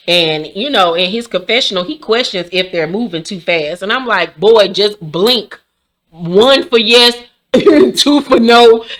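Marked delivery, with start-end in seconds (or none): 4.4-8.8 slow, deliberate and louder; mock hostage code. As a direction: spell it out slowly like a secret signal. Mock hostage signal advice.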